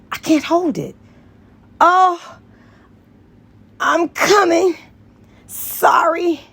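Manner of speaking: high, loud, building up to a cry; climax cry. Acted. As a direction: let it peak and break. Character climaxing in story.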